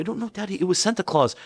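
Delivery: put-on high, small kid voice; insistent child. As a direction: imitate a child correcting dad. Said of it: "And they're like".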